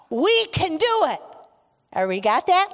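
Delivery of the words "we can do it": high, rousing group cheer; enthusiastic. Shown as a demonstration. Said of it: "with enthusiasm".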